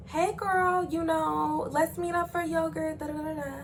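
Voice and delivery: high, singsong sweet voice; mock-sweet phone chat; fake-friendly invite. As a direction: coo it high like a phony pal. Friend coaches fake friendly call.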